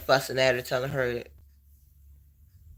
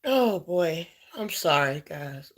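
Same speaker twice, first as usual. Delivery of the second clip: real tears, trying to recover; crying apology; 4 semitones higher, slower than usual. Real, not acted. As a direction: gather yourself through tears. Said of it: "I did not mean to cry on here".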